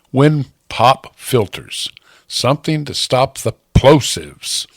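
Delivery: ordinary delivery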